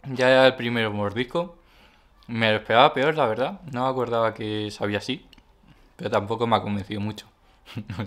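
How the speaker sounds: eating and tasting aloud; chewing while talking. Real, not acted. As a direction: mouth partly full of food. Seen 0: Bitten bun in hand; jaw and lips move as if chewing throughout.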